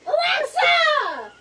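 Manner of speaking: high, loud, slow holler; angry drawn-out shout. Real, not acted. Really mad at the silent smart speaker, angry shout. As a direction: stretch the name into an exasperated yell.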